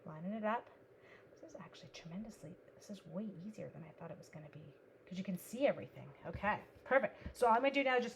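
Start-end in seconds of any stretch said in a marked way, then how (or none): 1.4-2.6 whisper. Real, not acted. Whispers while working.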